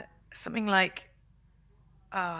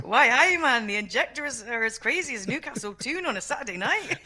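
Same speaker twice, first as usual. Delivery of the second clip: put-on Geordie accent; 5 semitones higher, 4 dB louder than usual. Mock Geordie engineer line.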